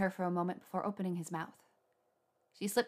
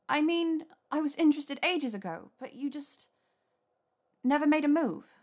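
higher, louder exasperated woman; 7 semitones higher, 5 dB louder than usual. A narrator's reading. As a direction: throw up your hands in despair. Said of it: "tossing a hand up in apparent despair".

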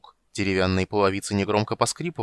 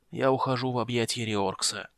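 softer, frail dying whisper of a voice; very old faint voice; 3 dB quieter than usual. Acted. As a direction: fade out like a dying old man. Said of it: "слабым голосом выговорил гном".

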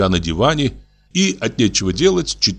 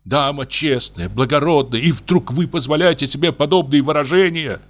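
trembling voice; 6 semitones higher than usual. A narrator's reading. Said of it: "сказал он дрожащим голосом".

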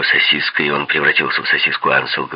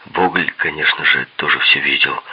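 usual speaking voice

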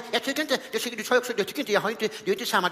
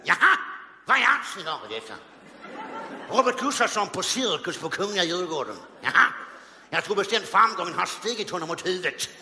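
Sten Broman voice. Retold or imitated. Comedian imagining Sten Broman reviewing his show, pompous. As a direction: sniff at the show like a snob. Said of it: "Tänk om han hade levt idag".

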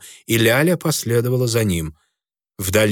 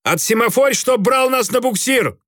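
shouting; 12 semitones higher than usual. A narrator's reading. Captain orders in battle.

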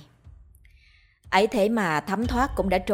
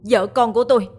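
louder and louder, breaking into a high cry; anguished shout; 4 semitones higher, 6 dB louder, faster than usual. Acted. Mạnh shouting at the ghost who killed his family, anguished. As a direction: scream the why at her in grief. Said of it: "đau đớn mà hét lên".

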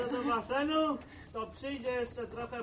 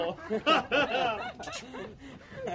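loud chuckle at his own joke; hearty laugh; 2 semitones higher, 3 dB louder than usual. Acted. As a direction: laugh after your own quip. Old man laughs at own joke.

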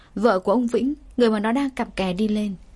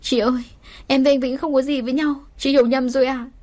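tearful begging; 5 semitones higher than usual. Acted. Mistress crying to the furious wife, tearful begging. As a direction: plead through tears for mercy. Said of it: "bắt đầu khóc lóc van xin".

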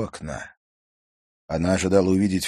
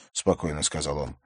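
usual speaking voice

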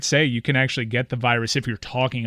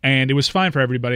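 usual speaking voice

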